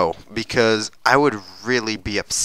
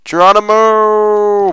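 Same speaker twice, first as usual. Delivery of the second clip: drawn-out Geronimo yell; 11 semitones higher, 11 dB louder, much slower than usual. Real, not acted. Jumping off mountain.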